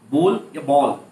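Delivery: बोल with o, then बॉल with open aw, loud; two pronunciations. Shown as a demonstration. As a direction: model both बोल and बॉल. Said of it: "both are correct".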